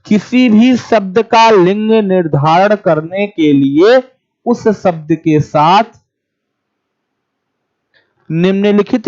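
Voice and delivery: slow, word by word, so students can write; slow dictation. Shown as a demonstration. Teacher dictating notes to the class, slowly and clearly. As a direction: dictate each phrase slowly with pauses. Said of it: "तीनों विशेषणों को नीचे लिख लीजिए".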